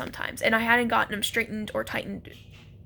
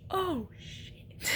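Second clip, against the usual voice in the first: imitating boyfriend's dread; 7 semitones higher, 10 dB quieter than usual. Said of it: "and he's like".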